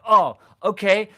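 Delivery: high, loud, drawn-out okay; delighted reaction. Retold or imitated. Sixth grader getting permission to invent, delighted. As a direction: retell a kid's gleeful realisation.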